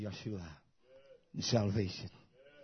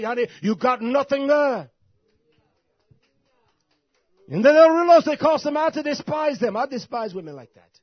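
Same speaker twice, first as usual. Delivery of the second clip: high, very loud shouted denunciation; roaring sermon rebuke at a high pitch; full-force preaching; 11 semitones higher, 17 dB louder than usual. Real, not acted. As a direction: thunder it out from the pulpit, drawing words out.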